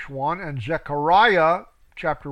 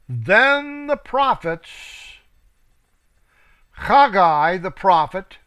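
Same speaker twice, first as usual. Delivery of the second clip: slow, solemn proclaiming of scripture; scripture reading; 6 dB louder, slower than usual. Acted. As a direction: read it out like a preacher. Reads scripture aloud.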